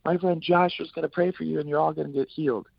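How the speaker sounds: retold high preacher announcement, easing down; excited high proclamation, gradually settling; Caleb screaming. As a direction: imitate working a crowd. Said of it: "he's screaming".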